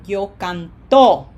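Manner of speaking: canto with the weight on a final TO; slow canto, punch the last syllable TO; misplaced stress. Shown as a demonstration. Teacher showing what happens without proper emphasis, canto stressed on TO. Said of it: "If we don't put it there".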